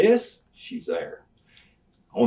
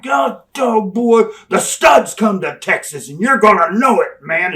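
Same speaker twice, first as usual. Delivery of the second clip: higher, louder ranting wrestling promo, near shouting; shouted heel promo; 12 semitones higher, 11 dB louder than usual. Retold or imitated. Wrestler yelling insults at Texas fans, loud higher rant. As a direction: rant like a heel working the crowd.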